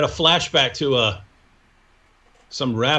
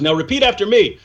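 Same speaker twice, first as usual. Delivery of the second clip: higher, louder solemn chant; Muhammad voice; 6 semitones higher, 3 dB louder than usual. Retold or imitated. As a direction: intone it like a prophet. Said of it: "And Muhammad goes".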